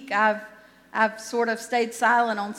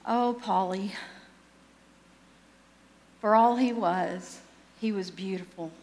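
reflective father. Retold or imitated. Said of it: "father is reflecting on his life".

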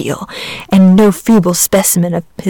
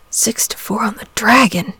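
softer, sadly murmured to himself; 3 semitones higher, 4 dB quieter than usual. A narrator's reading. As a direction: murmur it softly, sad and resigned. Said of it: "murmured Saint George sadly".

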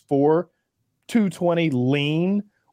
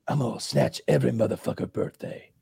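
low-key, softer imitation of an action star; Steven Seagal; 3 semitones lower, 5 dB quieter than usual. Repeating a direct quote for friends, subdued imitation. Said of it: "that's a direct quote".

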